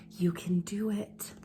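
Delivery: soft, far-off whisper of support; imagined mother whispering encouragement to her swimmer. Acted. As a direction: breathe it like a memory.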